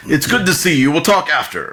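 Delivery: high, loud jovial aside; hearty speaker. Acted. Speaker telling the rogue they will talk after, high and hearty. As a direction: sound friendly in public. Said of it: "He's like".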